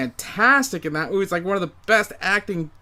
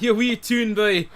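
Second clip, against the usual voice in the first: higher, jokey Scottish brogue; mock Scottish accent; 6 semitones higher, 3 dB louder than usual. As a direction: tease like a cartoon Scottish groundskeeper. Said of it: "can we just call him Willie?".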